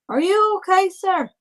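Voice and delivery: high-pitched, concerned character voice; high, courteous, worried query; polite concern. Acted. Character checks on coughing old man.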